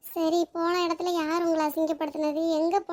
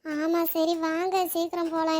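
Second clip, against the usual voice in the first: usual speaking voice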